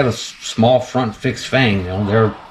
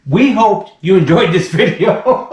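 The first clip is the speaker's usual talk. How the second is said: high, loud and slow, laughing through it; laughing sign-off; 8 semitones higher, 4 dB louder, slower than usual. Real, not acted. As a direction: wrap up the video while still laughing.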